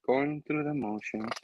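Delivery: low, slow, soft mock echo; echoing flatly. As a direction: repeat it back dryly. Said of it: "And she's like".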